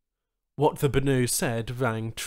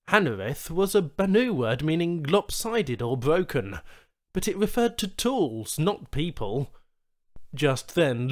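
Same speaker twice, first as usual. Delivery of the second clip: ordinary delivery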